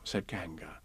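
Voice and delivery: ordinary delivery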